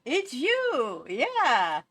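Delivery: high, loud delighted hello; excited greeting. Acted. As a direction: greet a friend you missed. Greeting a Sim.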